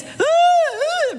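high wailing cry. Retold or imitated. Guest pastor overhearing the wife, high wailing. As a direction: wail out of control. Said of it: "he's hearing this".

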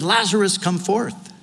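cried out across the grave to a dead friend; loud cry. Retold or imitated. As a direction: call him out of the tomb. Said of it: "Jesus cried with a loud voice".

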